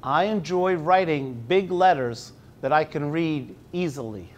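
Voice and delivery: slow, measured picture-book reading; reading to children. Acted. Teacher reading the book aloud slowly to the class. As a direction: read it slowly to five-year-olds.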